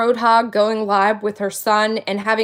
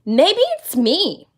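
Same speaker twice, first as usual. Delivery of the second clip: sarcastic self-reflection; 4 semitones higher, slower than usual. Retold or imitated. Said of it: "I would start thinking to myself".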